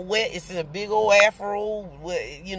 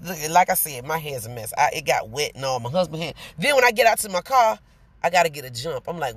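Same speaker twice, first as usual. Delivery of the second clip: ordinary delivery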